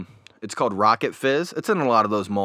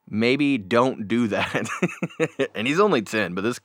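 ordinary delivery

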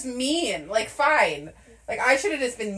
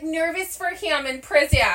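higher, slipping into Fargo vowels; Fargo accent; 6 semitones higher than usual. Real, not acted. Girlfriend nervous for him in prison, Fargo accent. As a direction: let a Fargo accent creep in. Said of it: "where the Fargo accent comes from".

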